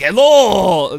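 loud, cartoonish Russian-accented hello; a mock Russian greeting; bad Russian accent. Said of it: "everybody apparently wants Russian guy".

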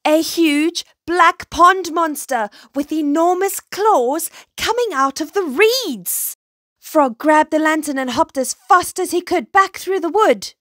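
high, loud, urgent storytelling; breathless-paced, dramatic alarm; alarmed narration. A narrator's reading. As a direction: build the scare of a monster sighting. Monster appears, frog flees.

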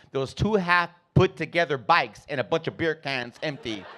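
ordinary talk, nothing marked

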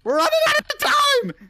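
very high, frantic yell; panicked shout. Real, not acted. Player hurrying partner through puzzle, panicked high shout. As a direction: scream it as the clock runs down.

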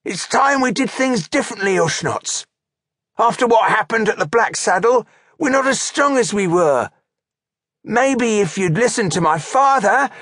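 high, insistent orc underling voice; defiant orc trooper. A narrator's reading. Troublemaker orc blaming his chieftain before the troops, defiant. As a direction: press the complaint in a loud, high voice.

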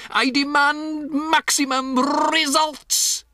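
very high, slow, loud screech; shrieked demand. A narrator's reading. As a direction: roll the r and hammer the order. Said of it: "shrieked the Grand High Witch".

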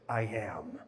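low and soft, almost under the breath; quiet declaration. Retold or imitated. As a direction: let the words sink down quietly. Jesus answering, said low.

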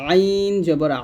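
আইন জবর আ with আ squeezed from mid-throat; pressed throat ayin. Shown as a demonstration. Teacher showing learners the mid-throat letter, pressed ayin. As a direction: press the throat on the vowels of আইন and আ, held long. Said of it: "গলার মাঝখান থেকে একটু চাপ দিয়ে".

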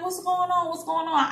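high-pitched teacher's questioning, retold; a hint of a teacher checking on trouble; lightly voiced concerned teacher. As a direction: imitate a teacher arriving to find out.

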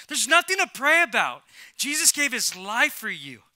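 high, very loud impassioned preaching; shouted preacher's rebuke; preaching at full force. Real, not acted. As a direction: drive each line home at the top of your voice.

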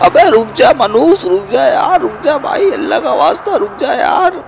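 very high wailing plea; desperate wail. Acted. Begging the rival to stop, very high wailing plea. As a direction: beg with all you've got.

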